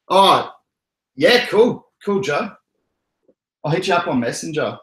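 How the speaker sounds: high-pitched put-on Australian; exaggerated Australian, pitched well up; Aussie accent. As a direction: play a keen Aussie mate. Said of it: "I was doing the Aussie accent".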